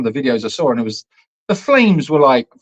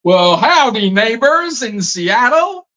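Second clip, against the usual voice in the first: high, drawn-out hammed-up American howdy; mock American accent; 10 semitones higher, 4 dB louder, slower than usual. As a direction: put on a big American accent. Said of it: "Don't think they speak like that".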